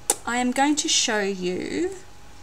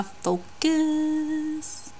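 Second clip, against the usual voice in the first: high, soft sing-song coax; 6 semitones higher, 5 dB quieter than usual. Real, not acted. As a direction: coax the camera like a pet. Coaxing camera to focus.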